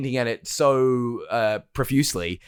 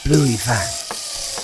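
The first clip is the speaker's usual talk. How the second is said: pirate voice; much slower than usual. Acted. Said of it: "ended up a little bit piratey".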